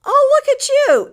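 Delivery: high, loud, gushing delight. Real, not acted. Host gushing as she greets a viewer. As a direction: coo over someone you adore.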